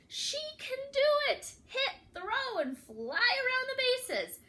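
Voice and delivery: high, excited cheering; cheering crowd. Acted. Crowd cheering Grandma on, high and excited. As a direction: cheer her on from the stands.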